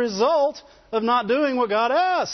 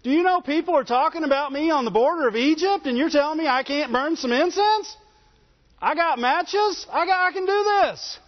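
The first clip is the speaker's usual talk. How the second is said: higher, fast boastful rant that slows on the matches; a swaggering king, sure he can do it himself; mock bragging Uzziah; arrogant king; 7 semitones higher than usual. Uzziah boasting against the priests.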